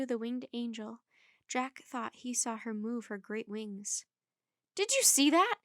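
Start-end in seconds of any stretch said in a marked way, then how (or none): 4.8-5.6 high, loud startled question; excited cry. A narrator's reading. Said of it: "he cried".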